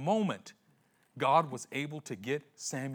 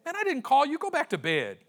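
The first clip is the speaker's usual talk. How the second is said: dismissive priest; 10 semitones higher than usual. Acted. Eli sending Samuel back.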